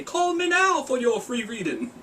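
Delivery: high, slow TV psychic with fake Jamaican accent; Miss Cleo. Vlogger mimicking an old psychic commercial, high mock Jamaican accent. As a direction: parody a psychic hotline pitch, drawn out and high. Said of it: "she did sound like Miss Cleo".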